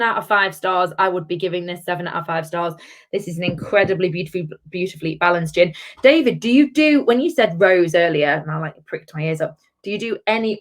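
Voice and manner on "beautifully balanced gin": ordinary talk, nothing marked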